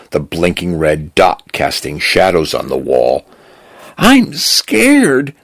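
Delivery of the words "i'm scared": high, frightened little voice; holding back tears. A narrator's reading. Cal confessing fear to Desi, high and near tears. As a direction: say it high and scared, tears held in. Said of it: "like he was holding back tears".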